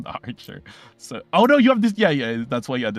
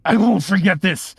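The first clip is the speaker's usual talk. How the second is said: higher, louder vengeful vow through pain; bloodied villain's vow; 7 semitones higher, 6 dB louder than usual. Acted. Wounded enemy swearing revenge as he flees, strained. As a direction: spit the threat out after coughing blood. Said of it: "He coughs blood".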